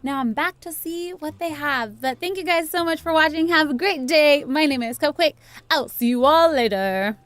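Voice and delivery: loud, fast youtuber sign-off; upbeat outro. Real, not acted. Host signs off to her viewers, peppy outro. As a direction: rattle off the goodbye with energy.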